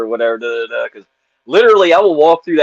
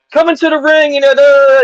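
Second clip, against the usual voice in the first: high, loud announcer yell; ring announcer scream; 13 semitones higher, 6 dB louder than usual. Retold or imitated. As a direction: belt it out like the ring intro. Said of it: "and I'm screaming".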